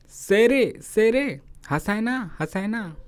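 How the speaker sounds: calling names across the field, high. Retold or imitated. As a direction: call out to them from afar. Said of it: "आवाज़ दी". (दूर से पुकारना)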